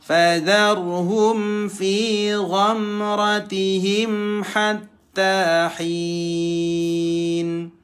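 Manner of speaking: slow chanted tajwid reading; Quran recitation. Shown as a demonstration. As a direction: recite clearly, throaty gh. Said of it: "Kita lanjutkan masih di gha". (bacaan Al-Quran pelan dan jelas)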